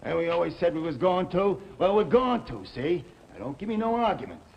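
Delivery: heated insistence, voice up high and loud; angry outburst. Acted. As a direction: shout down any argument. Chuck defends marrying Cora.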